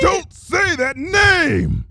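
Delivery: slow, high, screaming command. A narrator's reading. Luna forbidding Trixie to name Celestia, screaming. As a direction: scream it, each word forced. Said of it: "Luna screamed".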